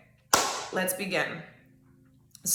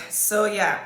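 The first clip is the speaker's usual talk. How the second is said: ordinary delivery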